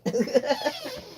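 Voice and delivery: short laugh, squeaked up high; a giggle at her own joke; high squeaky laugh. Real, not acted.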